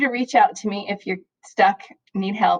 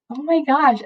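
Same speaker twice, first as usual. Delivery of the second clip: much higher, stretched oh my gosh; emotional oh my gosh; 8 semitones higher than usual. Real, not acted. Teacher recalling taping her last lesson, high emotional exclaim. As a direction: burst out with sudden feeling. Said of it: "I got a little bit emotional".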